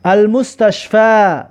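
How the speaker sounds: slow, with المستشفی ending in a long a, al-mustashfa; teacher modelling المستشفی; slow Arabic demo. Shown as a demonstration. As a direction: say المستشفی slowly, syllable by syllable. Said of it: "اهمیت بدید".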